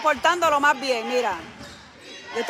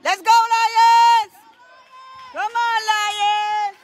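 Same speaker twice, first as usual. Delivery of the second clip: high, loud, long drawn-out fan yell; cheering shout; 8 semitones higher, 4 dB louder, much slower than usual. Real, not acted. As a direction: holler it across the court, stretching the vowels. Cheering team at game. (grito de ánimo alargado)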